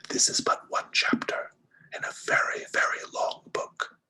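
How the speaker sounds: slow, eerie whispered voice; voice from the fire. Acted. Game master as a face in the fire speaks, slow whisper. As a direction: whisper it slowly, without any voice. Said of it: "a voice coming from the fire that says".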